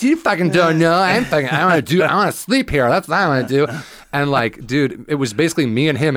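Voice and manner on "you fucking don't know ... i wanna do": put-on high and loud, sloppy drunk woman ranting; drunk heckler voice. Comic replays the drunk heckler's rant, high, loud and slurred. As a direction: whine it out like a wasted barfly who won't shut up. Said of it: "'cause you did that voice".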